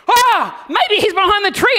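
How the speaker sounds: put-on high, loud eager child voice; excited kids. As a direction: realise where Dad hides. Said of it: "And they went".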